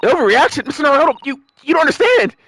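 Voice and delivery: put-on high, breathless young girl in alarm; excited girl voice. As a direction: gasp it out like a startled kid. Child assistant warns of danger.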